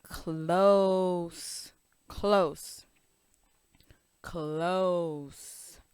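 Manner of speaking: "close" with a stretched, rounded long oh; slow "close", hold the oh; long O demo. Shown as a demonstration. Teacher modelling long O for kids. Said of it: "the long one is oh".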